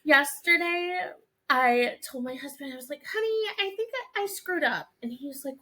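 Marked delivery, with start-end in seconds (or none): 3.0-4.9 higher-pitched, hesitant "honey" confession; mock confession. Wife breaks prank news to husband, mock sheepish. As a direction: own up sheepishly, as a put-on.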